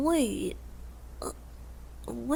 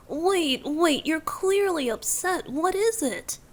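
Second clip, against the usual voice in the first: anxious plea; 6 semitones higher than usual. Acted. Pleads with upset listener.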